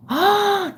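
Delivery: quick delighted gasp; sharp gasp. Real, not acted. Streamer gasping at her boyfriend calling her wifey, delighted gasp. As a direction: catch your breath in delight.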